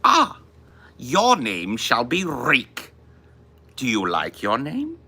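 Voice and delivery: slow, high, cruel mocking villain voice; menacing villain. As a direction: drawl it out like a sadistic lord with a cackle. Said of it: "imitate any actor from Game of Thrones?".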